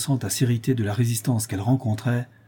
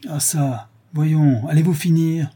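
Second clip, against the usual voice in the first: raised, higher scolding threat; menacing cry; 5 semitones higher, 4 dB louder, slower than usual. A narrator's reading. Lapidary chasing the old woman off, menacing. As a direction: snap it as a warning. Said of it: "s'écria Morel d'une voix menaçante".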